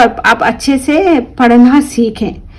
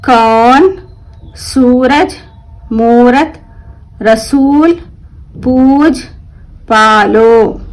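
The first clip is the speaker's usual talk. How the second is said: slow word-list reading; much slower than usual. Shown as a demonstration. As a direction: read every word clearly for the class. Reads word list aloud. (धीमे और साफ़ शब्द पढ़ना)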